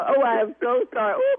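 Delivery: ordinary talk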